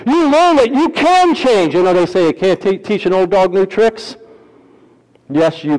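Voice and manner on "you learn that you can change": slow, high, emphatic preacher; fervent sermon delivery; preaching. Acted. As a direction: proclaim it slowly from the pulpit.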